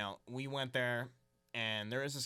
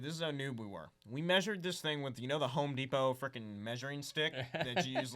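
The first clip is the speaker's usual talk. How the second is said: ordinary talk, nothing marked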